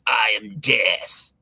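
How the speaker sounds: low, slow, ominous Death; Death's voice. Retold or imitated. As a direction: intone it slowly like the reaper. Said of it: "And he goes".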